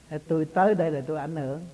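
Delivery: ordinary talk, nothing marked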